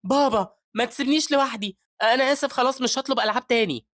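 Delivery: put-on high, loud child's plea; pleading little child. Child running to the man he calls daddy, pleading. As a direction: beg like a small child afraid of being left. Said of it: "بيقول للعيّل وهو بيجري على الراجل".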